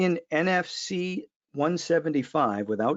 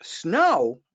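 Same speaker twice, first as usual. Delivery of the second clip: high, raised-voice teasing; calling out in disbelief; 7 semitones higher, 4 dB louder than usual. Real, not acted. Host ribs listener Martin about shovelling snow, high incredulous call. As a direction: exclaim it higher, in mock amazement.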